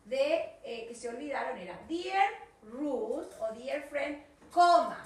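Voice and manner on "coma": coma said much louder and higher; emphatic coma. Shown as a demonstration. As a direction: punch the word coma. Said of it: "No me puedo olvidar esa coma".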